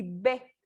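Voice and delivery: B said alone after a pause, low; letter sound demo. Shown as a demonstration. Teacher contrasting the two Bs, letter sound demo. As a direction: set this B apart from the other. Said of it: "Diferencia entre las Bs".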